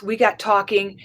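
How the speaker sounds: normal talking voice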